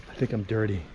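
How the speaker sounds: muttered aside. Real, not acted.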